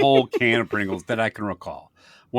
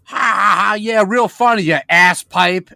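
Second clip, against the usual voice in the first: sarcastic shout; 11 semitones higher, 12 dB louder than usual. Retold or imitated. Grandpa mocking laughing teenagers.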